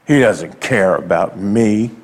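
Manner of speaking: lightly voiced grumbler; mock grumble. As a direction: sulk it like a resentful grumbler. Voicing a grumbler against God.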